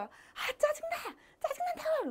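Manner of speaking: acted high-pitched cry of irritation; angry outburst. Patient suddenly raging at the nurse in counseling, angry outburst. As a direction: throw a mock fit, pitch shooting way up. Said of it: "막 소리를 질러요".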